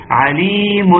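stretched "عليم" with a sounded tanween; audible final noon. Shown as a demonstration. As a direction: let the n at the end of "عليم" ring. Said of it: "تنتهي بايه عليم؟".

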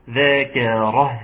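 "ذكره" very slowly, ending on a thin breathed ه; light final ha. Shown as a demonstration. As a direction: draw out "ذكره" and release the ه lightly. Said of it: "ويا سلام لو نرقق الهاء". (نطق "ذكره" ببطء بهاء مرققة في الآخر)

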